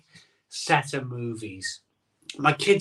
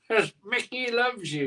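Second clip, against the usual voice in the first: higher, rasping trainer voice; Rocky's trainer; 8 semitones higher than usual. Retold or imitated. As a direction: growl it like an old boxing coach. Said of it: "Rocky's trainer".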